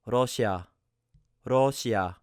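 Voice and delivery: Russia said the Korean way, light flapped R. Shown as a demonstration. Teacher demonstrates the Korean word for Russia. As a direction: say Russia with a quick tapped R. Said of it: "the R sound is found usually when this is at the beginning".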